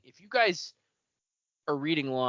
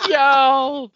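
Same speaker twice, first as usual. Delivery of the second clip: echoed cry; 10 semitones higher, 9 dB louder than usual. Retold or imitated. Echoing the sinking cry.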